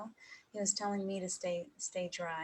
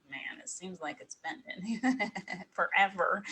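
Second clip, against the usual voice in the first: slow, louder, laughing mock complaint; husband's joking drawl; 6 dB louder, slower than usual. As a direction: tease her like he always did, stretching it out. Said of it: "in his joking way".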